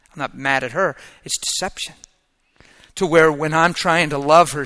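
usual speaking voice

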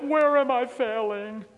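high, loud, slow wail; mock devastated mother. Condemned mother cries to her pastor, exaggerated wail. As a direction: imitate a mother's drawn-out lament. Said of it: "mothers come to me devastated, condemned".

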